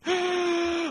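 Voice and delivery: high shrill burst of laughter; shrieking laugh. Real, not acted. Host bursting out at a bad [name] pun, shrieking laugh. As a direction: cackle out at the pun.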